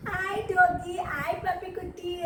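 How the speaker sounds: put-on teacher shows kids how to greet a dog, cooing; sweet greeting. Shown as a demonstration. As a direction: coo to the dog. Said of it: "You should say".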